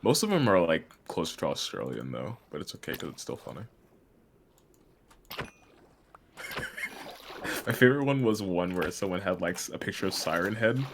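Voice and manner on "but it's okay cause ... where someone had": usual speaking voice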